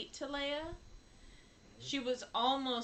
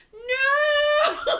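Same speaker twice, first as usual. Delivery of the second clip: very high, very loud crying shout; child's anguished cry; 16 dB louder than usual. Retold or imitated. Mom imitating her little boy in the theater, high crying shout. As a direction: wail it like a child watching Mufasa die. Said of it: "And he was like".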